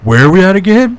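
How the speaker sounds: mock a speaker losing his place, on the edge of laughing; Biden. Imitating Biden losing his thread mid-interview, mock bewildered. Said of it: "his mind just goes blank".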